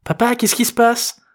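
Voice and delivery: put-on very high-pitched girl's voice; questioning daughter. A narrator's reading. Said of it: "interrogea Juliette".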